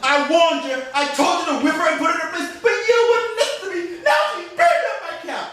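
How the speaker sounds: mock fury at her husband; angry nagging wife. Missus scolds master.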